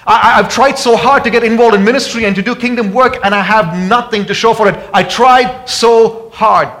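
loud, high, pleading preacher's cry; preaching at full force. Acted. Preacher voicing a weary believer to the church, loud and pleading. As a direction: voice the hurting listener's despair, full force.

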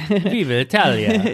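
put-on hammy Italian accent, high and slow. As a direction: belt it like a proud Italian. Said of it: "Can you say it in an Italian accent?".

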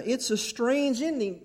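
ordinary talk, nothing marked

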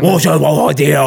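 hammed-up showing-off French greeting; mock French accent; showy French. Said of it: "They do not need to".

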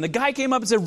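usual speaking voice

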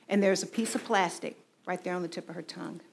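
normal talking voice

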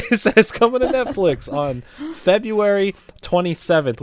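laughing. Real, not acted. Laughing while reading the news.

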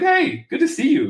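high-pitched, glad hello; happy greeting. Retold or imitated. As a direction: greet him happily, pitch jumping up. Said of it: "I was happy to see him".

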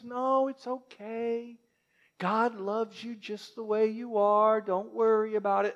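soft, slow, over-gentle reassurance; mock soothing pastor. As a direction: coo it like a pushover preacher. Mocking grace-only churches.

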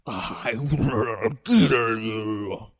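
demon voice. Acted. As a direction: drag it out like a demon.

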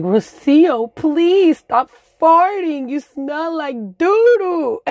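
put-on high, slow whiny kid voices; mock kid friends. Kindergarten friends complaining to Rocio, whiny mock voice. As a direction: friends whining at little Rocio, high-pitched and drawn out. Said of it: "my friends are like".

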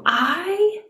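I jumps high in disbelief; a stunned question on I; shocked I. Shown as a demonstration. Host voicing a listener's amazed reaction to being chosen. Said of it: "Does that shock you that he chose you?".

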